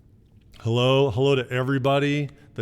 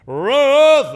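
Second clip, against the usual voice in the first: much louder, higher hollered name; bellow; 15 dB louder than usual. Retold or imitated. Father bellows for his wife instead of using the intercom, loud bellow. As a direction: mimic dad bellowing through the house. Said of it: "when you can just bellow".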